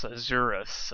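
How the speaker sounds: ordinary delivery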